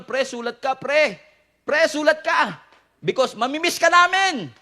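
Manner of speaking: high, plaintive mock goodbye; left-behind toothbrushes' farewell. Toothbrushes left in the store bidding their friend goodbye, mock farewell. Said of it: "ano kaya sinasabi ng mga naiwan?".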